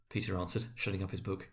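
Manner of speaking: plain reading voice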